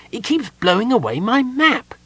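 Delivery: high-pitched peevish gnome; irritable complaint. A narrator's reading. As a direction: whine crossly about a nuisance. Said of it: "he added irritably".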